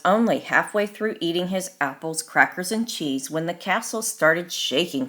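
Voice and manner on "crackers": usual speaking voice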